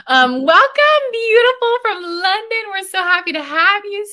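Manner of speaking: excited welcome. Real, not acted. Host greeting a new attendee.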